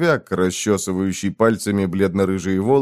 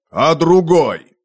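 slow, louder call from the yard; called from below; 7 semitones higher, 5 dB louder, much slower than usual. Acted. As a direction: shout up from the courtyard. Раздалось снизу, со двора. (издалека, снизу)

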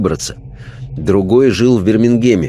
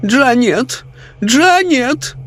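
put-on much higher, imperious old lady calling her maid; high, demanding lady's call; woman's voice calling; much slower than usual. A narrator's reading. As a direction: summon the maid from bed. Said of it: "женским голосом произнёс".

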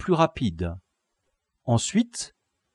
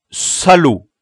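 loud, higher outburst; angry curse; 7 semitones higher, 10 dB louder than usual. Acted. Leader cursing captive over a lace, angry outburst. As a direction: spit out the insult.

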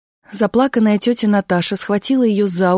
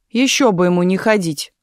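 usual speaking voice

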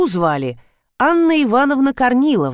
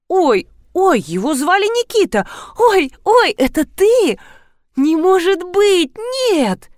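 high, louder gasping "ой, ой"; overwhelmed by sudden recognition; high excited cries of disbelief; 9 semitones higher, 3 dB louder than usual. Acted. Woman recognizes childhood friend.

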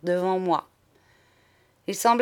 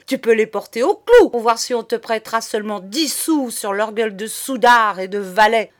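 loud, raised outburst of insults; furious tirade; 3 semitones higher, 6 dB louder than usual. A narrator's reading. Madame cutting off monsieur's money, raging. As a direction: cut him off for good, loudly. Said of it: "rugit-elle enfin".

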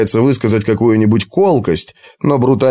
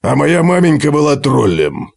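grumbling dwarf; 4 semitones higher than usual. Acted. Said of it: "пробурчал Готрек".

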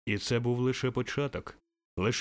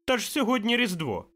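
very high, loud, incredulous cry; surprised boy; 12 semitones higher, 5 dB louder than usual. A narrator's reading. Boy telling Scrooge the day, loudly amazed. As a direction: exclaim it as an astonished child. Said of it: "знову здивувався хлопчик".

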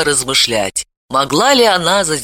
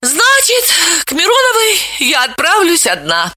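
resign herself with a sigh, pitched up; sighing resolve; 13 semitones higher than usual. A narrator's reading. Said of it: "вздохнула Вета".

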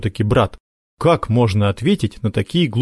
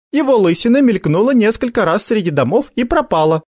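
put-on girl's voice; 11 semitones higher, 3 dB louder than usual.

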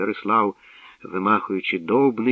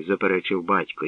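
ordinary delivery, nothing marked

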